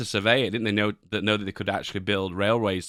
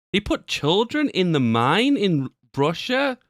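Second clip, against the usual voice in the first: shocked disbelief; 8 semitones higher, 3 dB louder, slower than usual. Real, not acted. Shocked reaction to clip.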